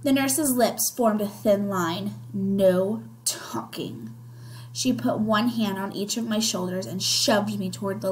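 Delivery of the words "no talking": slow, near-whispered command; stern hush. A narrator's reading. Nurse silencing a pleading child, stern slow hush.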